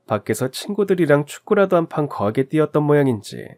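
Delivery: ordinary delivery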